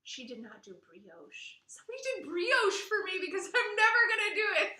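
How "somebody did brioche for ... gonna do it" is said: fast, higher and louder, laughing through it; laughing delight. Real, not acted. To her co-host about the brioche, laughing delight. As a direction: let the joy spill out in a laugh.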